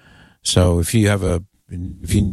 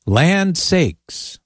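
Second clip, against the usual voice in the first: put-on southern accent; 8 semitones higher than usual. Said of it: "Why is your voice getting southern sounding".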